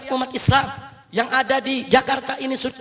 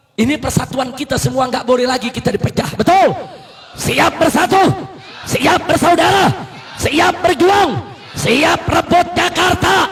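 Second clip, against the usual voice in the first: louder, higher rallying sermon, stretched shouted peaks; fiery preaching; 6 semitones higher, 7 dB louder than usual. Acted. Preacher rousing the crowd to unite, fiery shouted preaching. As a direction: whip the crowd up, word by word.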